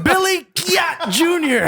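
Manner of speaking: "Giat" punched out high after a stretched "Billy"; emphatic hype announcement. Pretend. Host announcing the guest's joke name, "Giat" high and emphatic. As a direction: draw out the name and hit "Giat" hard. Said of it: "I like the way you say it".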